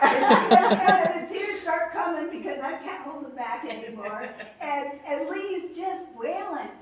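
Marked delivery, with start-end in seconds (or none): 0.0-4.3 laughing. Real, not acted. Laughing while retelling tearful phone call.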